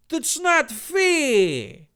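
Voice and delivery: very high, drawn-out mock whine; whining protest. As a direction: protest like a sulking child. Dave's acted whining protest.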